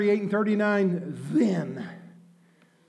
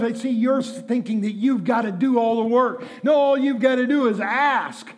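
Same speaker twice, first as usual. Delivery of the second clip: ordinary talk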